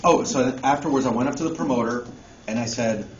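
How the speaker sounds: ordinary talk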